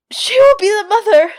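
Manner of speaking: high, loud anguished cry; sobbing witch. A narrator's reading. Tortured witch confessing to her tormentor, sobbing. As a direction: gasp it out high, desperate and tormented. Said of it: "stammered Lena Felt, sobbing".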